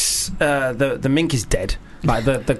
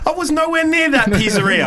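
mock high voice; 13 semitones higher than usual. Jokey denial in put-on voice.

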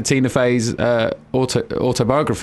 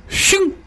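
mock sound effect.

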